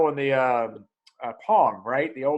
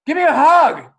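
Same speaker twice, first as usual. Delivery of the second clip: higher, louder imitation of the daughter asking for a hug; daughter's plea; 11 semitones higher, 10 dB louder than usual. Mother voicing her daughter who suddenly wants a hug, higher, louder. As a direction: play the teen suddenly demanding a hug.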